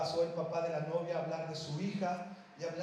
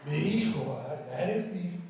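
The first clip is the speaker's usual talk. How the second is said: mock stern father-in-law; 5 semitones lower, 5 dB louder than usual. Father telling his daughter's future husband, mock gruff. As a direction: lower and louder, half-joking gruff father. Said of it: "así le voy a decir".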